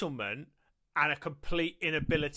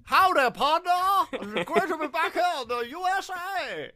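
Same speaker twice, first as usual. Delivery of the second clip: high-pitched, squeaky mock American; loud, hammed-up cowboy American, pitched way up; American accent; 11 semitones higher, 5 dB louder than usual. Shown as a demonstration. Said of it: "Let's hear your American accent".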